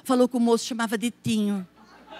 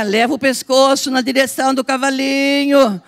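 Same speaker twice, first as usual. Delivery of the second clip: ordinary talk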